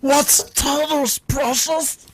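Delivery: very high, slow, creepy Gollum hiss; Gollum impression. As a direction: croon it slowly like Gollum. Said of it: "Boil 'em, smash 'em".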